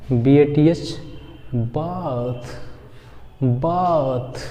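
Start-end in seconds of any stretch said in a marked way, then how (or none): 1.5-4.4 बाथ with long open aa, said softly; soft teacher demo of बाथ. Shown as a demonstration. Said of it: "क्या बोलेंगे इसको?".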